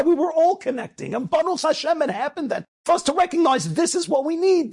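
high, driving exhortation; impassioned preaching. Real, not acted. Rabbi urging the crowd to connect, impassioned preaching. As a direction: preach it urgently, hitting the key words.

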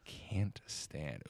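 soft breathy whisper. Real, not acted. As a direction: whisper it musingly. Repeats phrase in whisper.

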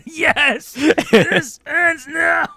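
very slow, high movie-hero vow; dramatic vow echoed. Pretend. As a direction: echo the hero's vow with gravity.

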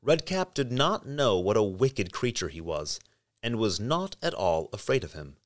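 usual speaking voice

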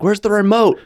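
high-pitched exasperated dad demanding; dad calling out. Retold or imitated. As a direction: imitate a dad hollering for something lost. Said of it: "my dad would always be like".

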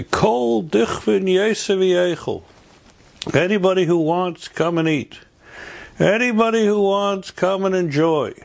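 high, ringing open invitation; Seder proclamation. Acted. All at the Seder table inviting anybody who wants, high proclamation. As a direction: proclaim it high, stretching the words. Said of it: "and we all say".

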